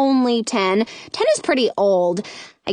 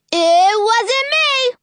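high, slow, loud holler; 12 semitones higher, 4 dB louder, slower than usual. Acted. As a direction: holler it in protest. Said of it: "he hollers".

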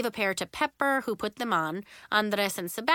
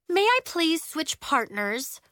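polite, higher-pitched request to an adult; Nori's talking-to-grown-ups voice; 8 semitones higher, 4 dB louder than usual. A narrator's reading. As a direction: ask the teacher very politely but insistently. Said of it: "in her talking to grown-ups voice".

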